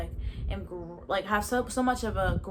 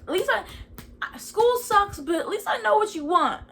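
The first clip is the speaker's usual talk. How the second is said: high, louder determined vow; fired-up resolve; 10 semitones higher, 6 dB louder than usual. Retold or imitated. Promising God she will do it, high and loud. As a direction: commit to it with new energy.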